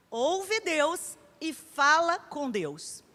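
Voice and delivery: each word stretched, very slow; very slow emphasis. Acted. Preacher slowly spelling out what a prophetess does. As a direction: lay it down word by word. Preacher repeats the key phrase.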